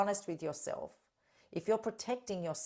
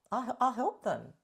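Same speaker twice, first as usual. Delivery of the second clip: normal talking voice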